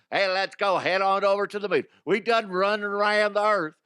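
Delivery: high, hammed-up eager character; rally the crew in a put-on voice; comic astronaut voice. Voices astronauts setting off for moon.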